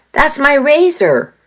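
mild read-aloud yell; narrated shout. A narrator's reading. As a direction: snap it out like a man furious over his razor. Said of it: "I heard Sam shout".

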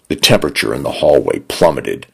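plain reading voice

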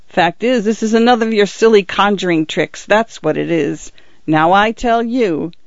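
mocking constable. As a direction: sneer at the silly conjuring trick. Constable Winch's mockery.

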